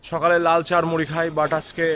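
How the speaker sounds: ordinary talk, nothing marked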